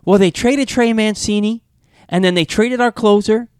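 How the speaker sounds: lightly voiced dismayed woman complaining; upset Orioles fan woman. Gym woman to a stranger in an Orioles hat, mock dismayed. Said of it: "She goes".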